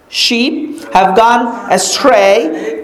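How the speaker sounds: ordinary talk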